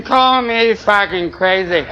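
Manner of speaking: very slow, each word drawn out; slow dramatic. Acted. Comic winding up his peace dream to the crowd, very slow. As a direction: savour it like a grand declaration.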